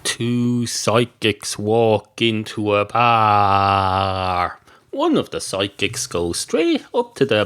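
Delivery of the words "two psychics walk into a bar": slow, deliberate joke setup. Acted.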